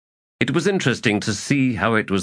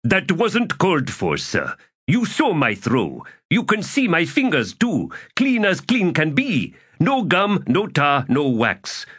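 high voice, a touch indignant; hurt innocence; 9 semitones higher than usual. Acted. Accused soldier defends clean fingers to an officer, hurt and innocent. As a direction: protest your innocence to a superior. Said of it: "displayed hurt and innocence, with just a touch of indignation".

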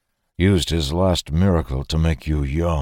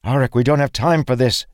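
higher, urgent appeal; pressing, strained plea; desperate; 8 semitones higher, 4 dB louder than usual. A narrator's reading. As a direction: plead desperately mid-fight. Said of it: "Dalen said desperately".

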